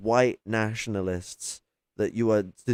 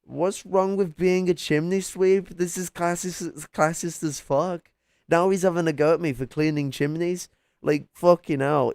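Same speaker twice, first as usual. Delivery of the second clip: higher, put-upon cockney sweep protesting; mock offended chimney sweep; 6 semitones higher than usual. Answering chat's chimney sweep jibe with hammed-up outrage. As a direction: play the insulted sweep for laughs. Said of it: "He sounds like a goddamn chimney sweep".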